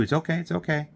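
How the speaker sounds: retold reassurance. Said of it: "I'm saying that too".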